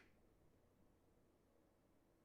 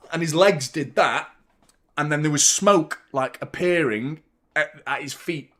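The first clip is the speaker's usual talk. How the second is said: ordinary talk, nothing marked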